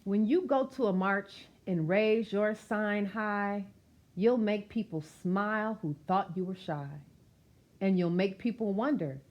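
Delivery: lively rhythmic read-aloud of a rhyming picture book; reading to children. Acted. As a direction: read it out warmly to a room of kids, bouncing the rhymes.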